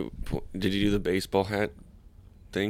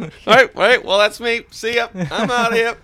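much higher, louder mock goodbye; hammed-up farewell; 10 dB louder than usual. Acting out a quick exit on a high note, hammed-up shout.

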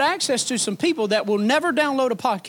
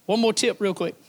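ordinary delivery

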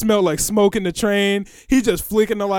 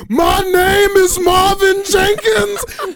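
slow, much higher and louder crying yell; sudden wailing shout; 12 semitones higher, 5 dB louder, much slower than usual. Retold or imitated. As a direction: burst into an anguished wail on the repeat. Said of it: "half a second and said".

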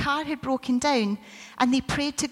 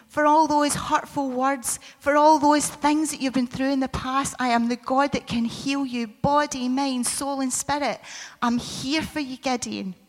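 louder, higher promise of healing; full-force preaching; 3 semitones higher, 5 dB louder than usual. Acted. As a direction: proclaim it like a rising sermon climax. Preaching climax as God.

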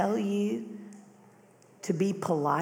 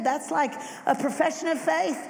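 ordinary delivery

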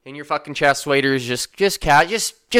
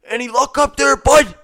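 higher, slurry call of a wasted stranger; drunk fisherman calling out; 9 semitones higher than usual. Retold or imitated. A wasted stranger calling out to the passing narrator, higher. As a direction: play the drunk guy hollering up the river.

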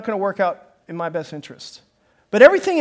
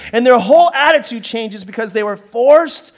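usual speaking voice

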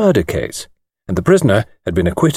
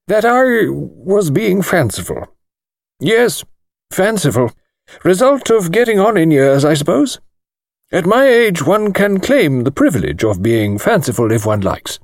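thin precise voice; 6 semitones higher than usual. A narrator's reading. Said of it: "thin, precise little voice".

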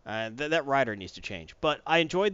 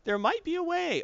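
higher, worried mock voice; panicked voice; 10 semitones higher than usual. Character warning the heroes, high and worried. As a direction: fret in a raised voice. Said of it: "It's like".

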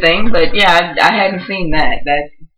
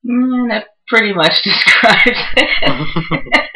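slow, laughing through words; 6 semitones higher, much slower than usual. Real, not acted. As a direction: laugh as you answer. Laughing while answering.

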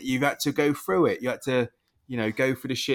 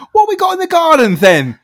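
high, loud hammed-up TV chef; mock celebrity chef voice; 5 semitones higher, 10 dB louder than usual. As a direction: send up a cheerful TV cook. Mocking chef voice again.

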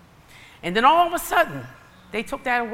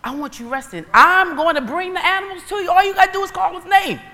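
rising, excited God voice, faster at the end; a light take on God, climbing higher; voicing God; 6 semitones higher than usual. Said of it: "God would say".

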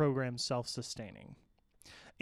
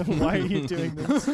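high, loud plea through laughter; laughing protest; 3 semitones higher, 8 dB louder than usual. Real, not acted. Presenter put on the spot by his co-hosts, laughing protest. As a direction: protest while cracking up.

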